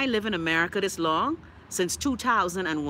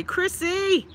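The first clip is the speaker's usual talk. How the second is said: high, drawn-out name call; mock-scandalised exclamation; 9 semitones higher than usual. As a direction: cry the name high, imitating a shocked fan. Reads out a viewer's exclamation.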